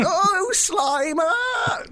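high, drawn-out excited shout; Rick Moranis impression. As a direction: squeal the name out slowly. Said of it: "that was my impression".

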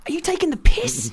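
high, indignant "taking" pushed up with a falling end; outraged disbelief. Retold or imitated. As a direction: caught off guard, voice jumping high. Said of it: "you're just like".

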